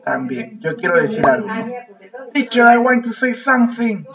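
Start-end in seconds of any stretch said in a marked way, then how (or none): 2.3-4.1 lightly voiced pupil, higher pitched; modelled pupil request. Shown as a demonstration. Teacher shows pupils how to ask to speak, in a higher voice. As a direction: pipe up to get the teacher's attention.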